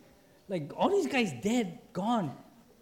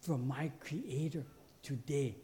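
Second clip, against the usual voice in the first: awed attitude; 3 semitones lower, 7 dB quieter than usual. Retold or imitated. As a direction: softly marvel at the privilege, lower voice. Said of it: "with an attitude like".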